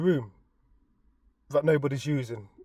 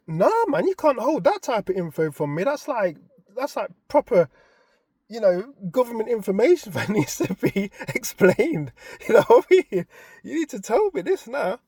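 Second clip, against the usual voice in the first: high, louder demand with laughs; irate, half laughing; 7 semitones higher, 6 dB louder than usual. Retold or imitated. Husband insisting his wife explain, high irate half-laughing. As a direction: push them to explain, amused outrage.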